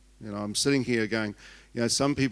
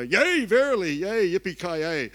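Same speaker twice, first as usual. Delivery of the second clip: high, loud, hammed-up King James prophet voice, dragging out the yippee-ki-yay; mock old-time prophet; 8 semitones higher, 8 dB louder, slower than usual. Pastor mocking how other people prophesy, in a booming hammed-up prophet voice. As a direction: parody the pompous prophets, then melt into a cowboy whoop. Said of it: "I don't prophesy like other people".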